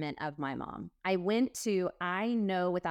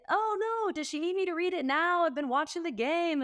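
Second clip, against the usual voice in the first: high, innocent mock dad voice, much higher; mock clueless dad; 10 semitones higher than usual. Dad answering stepmom from the couch, higher mock innocent voice. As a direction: play the oblivious dad caught mid-game.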